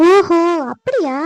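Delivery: slow, drawn-out mock understanding; a long, sceptical "oh, is that so"; sarcastic. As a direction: drag it out with loud, knowing scepticism. Wife scoffs at share investment news.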